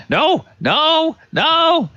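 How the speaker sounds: very high squealed cries; hammed-up falsetto panic; terrified witches. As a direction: squeal as if fleeing in terror. Women flee screaming from the threat.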